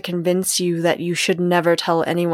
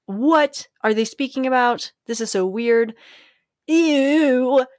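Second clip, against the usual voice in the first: imagined disgusted listener; 4 semitones higher than usual. Retold or imitated. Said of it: "you might have ... gone".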